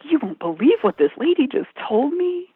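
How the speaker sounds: mock amazed gossip. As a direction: imitate telling coworkers astonishing news. Retelling how she spread the news.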